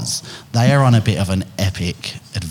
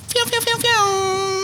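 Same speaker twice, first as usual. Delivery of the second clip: high whistled phew phew; whistling cheer; 3 dB quieter, much slower than usual. Real, not acted. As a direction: whistle the guest in. Cheering the guest's entrance.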